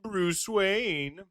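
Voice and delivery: high hello, then a slow put-on gentleman drone; hammed-up playboy voice, slow; fake Bruce Wayne voice. Batman faking his Bruce Wayne voice on the phone, slow put-on. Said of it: "Old Bruce Weird voice".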